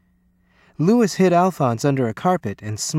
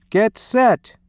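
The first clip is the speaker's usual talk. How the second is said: high, raised starting call; coach's bellow; 8 semitones higher, 4 dB louder than usual. A narrator's reading. Coach readying the swim team, high bellowed call. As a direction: call it out to the swimmers. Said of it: "bellowed the coach".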